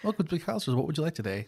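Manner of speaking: chirpy counter welcome; customer service voice. Retold or imitated. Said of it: "my customer service voice".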